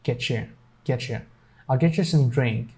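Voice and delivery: "get you" as "getcha"; cha sound. Shown as a demonstration. Teacher showing the other pronunciation of "get you", cha sound. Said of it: "a cha sound".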